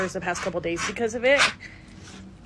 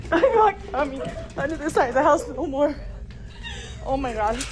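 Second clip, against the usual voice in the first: shaken; 6 semitones higher than usual. Real, not acted. Laughing off her fright.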